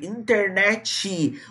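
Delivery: "internet" slowly as "inter-netchee"; added final vowel. Shown as a demonstration. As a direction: drag out "internet" with an ee ending. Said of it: "você estende essa vogal". ("internet" devagar, como "internétchi")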